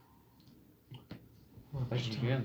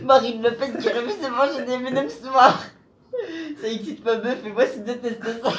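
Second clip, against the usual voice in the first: laughing; 6 semitones higher than usual. Real, not acted. Reading card while laughing.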